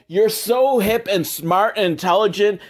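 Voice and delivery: ordinary talk, nothing marked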